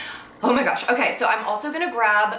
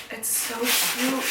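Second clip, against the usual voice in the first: slow, soft, close to tears; barely holding back tears over it; choked up; 4 dB quieter, slower than usual. Real, not acted. Missing her late cat, slow soft choked up. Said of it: "why am I about to cry".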